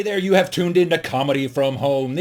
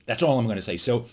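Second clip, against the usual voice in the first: ordinary talk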